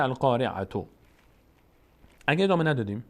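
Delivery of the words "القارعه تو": القارعه تو with the final t voiced as "tu"; continuing form. Shown as a demonstration. Teacher shows reading on without stopping, continuing form. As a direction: end القارعه on a sounded "-atu", ready to read on.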